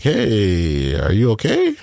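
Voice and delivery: drawn-out hey, rising question; careful check-in. Retold or imitated. Friend phoning to see if he's okay, drawn-out and tentative.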